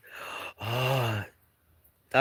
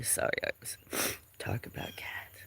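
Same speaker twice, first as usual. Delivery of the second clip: breathy whispered apology; whispering an apology to chat; whispered aside; 5 semitones lower, 13 dB quieter, faster than usual. Real, not acted. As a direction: drop to a whisper mid-sentence.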